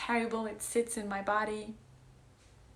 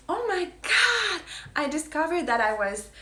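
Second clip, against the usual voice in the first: gushing friend; 8 semitones higher, 8 dB louder than usual. Retold or imitated. Friend recounting Inner Alchemy, gushing high and loud. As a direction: imitate a friend bursting with discovery. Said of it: "she's like".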